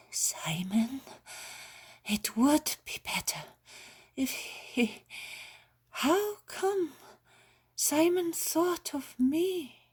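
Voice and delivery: put-on high, frail sick old woman's voice; a dying old woman, wary and weak; feverish old woman. A narrator's reading. Bedridden old woman doubting the healer at her bedside.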